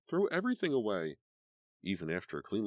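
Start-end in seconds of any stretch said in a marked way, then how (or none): 0.1-1.2 hysterical cry. A narrator's reading. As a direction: beg it in rising panic. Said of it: "still hysterical".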